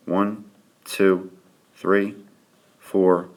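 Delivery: ordinary talk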